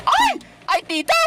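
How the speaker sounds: high yelp of surprise; startled yelp. Real, not acted. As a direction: yelp on suddenly seeing someone. Suddenly recognizes aunt character. (gulat na tili)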